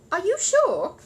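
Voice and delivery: high, loud anxious question. Acted. Mrs Brown asking Paddington about the watch, anxiously. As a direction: plead nervously. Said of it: "said Missus Brown anxiously".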